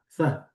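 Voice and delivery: "th" said with the tongue between the teeth; th sound. Shown as a demonstration. Teacher demonstrates the Hebrew tau without dagesh as "th". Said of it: "berbunyi th".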